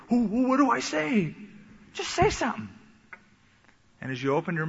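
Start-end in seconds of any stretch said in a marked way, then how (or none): 0.1-1.3 high, stammering panic; nervous stammer. Retold or imitated. A nervous believer asks the Spirit what to say, stammering high. 1.9-2.7 much higher, pressing voice; insistent urging. Retold or imitated. The Holy Spirit presses a hesitant believer, higher and more urgent.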